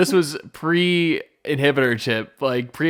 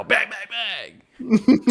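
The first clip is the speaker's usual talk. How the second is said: high blaster sounds; 9 semitones higher, slower than usual. Retold or imitated. Imitated blaster shots.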